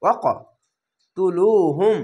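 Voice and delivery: "ওয়াকতুলুহুম" slowly, with a jolt after ওয়াক; pushed consonant. Shown as a demonstration. Teacher reciting "ওয়াকতুলুহুম" for learners, slow with a pushed ক. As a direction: bounce the ক, then draw out "ওয়াকতুলুহুম". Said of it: "ধাক্কা মেরে".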